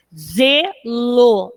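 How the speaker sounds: "Zelo" em duas sílabas separadas, "ze-lo"; separação silábica. Shown as a demonstration. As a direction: escanda "Zelo", com pausa entre "ze" e "lo". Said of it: "temos aí seis, sete sílabas". ("Zelo" dito sílaba por sílaba)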